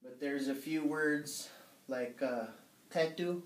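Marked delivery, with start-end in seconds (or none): 2.9-3.4 tetu said evenly, both syllables equal; no stress. Shown as a demonstration. Teacher showing a word with no stress, said evenly. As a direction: give tetu no stress at all. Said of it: "doesn't really have a stress".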